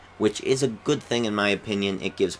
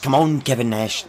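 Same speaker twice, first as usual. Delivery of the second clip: loud, higher-pitched attempt at a Scottish accent; rough Scottish imitation, raised and forceful; Drew McIntyre; 6 semitones higher, 5 dB louder than usual. Shown as a demonstration. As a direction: call out in a put-on Scottish accent. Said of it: "Yeah, see, there we go".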